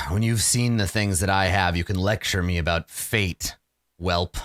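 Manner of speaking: low contemptuous growl; low snarling vampire. Acted. Vampire snarls at the young player, low and contemptuous. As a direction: sneer it down at the whelp.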